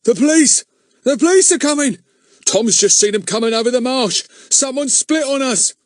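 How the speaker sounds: high, loud alarm shout down the passage; shouted warning. A narrator's reading. A lookout warns the gang, loud high shout. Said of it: "a loud voice shouted down the passage".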